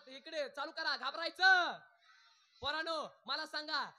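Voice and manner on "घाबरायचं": put-on loud, high call to the children. Shown as a demonstration. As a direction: shout the word for the crowd to echo. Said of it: "मोठ्यानं बोलायचं".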